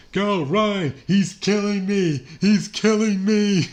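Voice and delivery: loud, high-pitched hammed-up victim cry; drawn-out, over-the-top movie victim shout; mock panicked yell. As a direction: imitate a dying man yelling out in panic. Acting a movie victim being killed.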